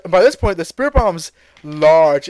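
ordinary delivery

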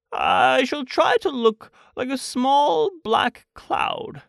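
high, slow, deliberate Pooh; earnest storybook bear. Acted. Pooh tells Christopher Robin his disguise plan, high and slow. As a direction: declare the plan slowly and earnestly.

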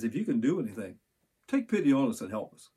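usual speaking voice

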